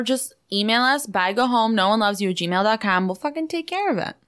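usual speaking voice

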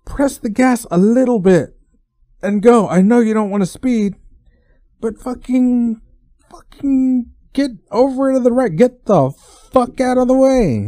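high-pitched, fed-up road rant; exasperated driver rant. Retold or imitated. Venting at slow drivers in traffic.

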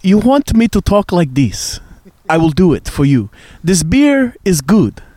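a playful Russian mobster voice; Russian accent. Pretend. Friend asks for a Russian accent by the fire, playful Russian accent. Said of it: "talking with the Russian accent".

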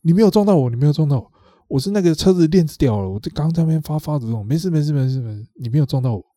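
音调拉高，急着说没事没事; 像怕被追究一样连声说没事; 紧张慌忙. Retold or imitated. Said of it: "他突然就很紧张地说". (模仿对方紧张地拉高嗓门连声说没事)

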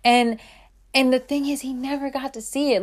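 usual speaking voice

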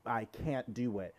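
ordinary talk, nothing marked

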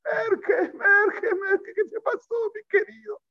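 very high, wailing mock cry; imitated grandmother's shriek. Grandmother finding her hurt grandson, imitated high panicked wail. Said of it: "Empezó a gritar".

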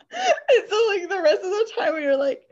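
high fast laughing words. Real, not acted. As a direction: laugh your way through it. Laughing while telling.

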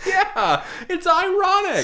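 laughing through the words, very high; high laughing squeal. Real, not acted. Laughing over his shirt joke.

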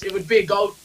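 ordinary talk

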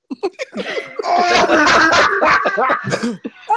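loud high cackle at own joke; cracking up at the punchline; big laugh. Real, not acted. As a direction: burst out laughing.

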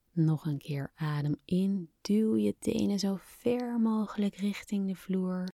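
hushed guided meditation voice; breathy, calm whisper guiding relaxation. Acted. Guided relaxation exercise begins.

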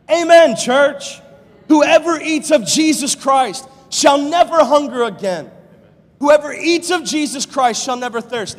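loud, high fervent preaching; full-voiced pulpit fervor; preaching at full force. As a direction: lift the church with it.

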